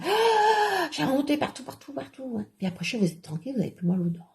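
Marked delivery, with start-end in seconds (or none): 0.0-2.4 high squealed cry that fades away; acted sting. Explaining a garlic toothache cure, high fading squeal. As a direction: squeal high, then let it fade.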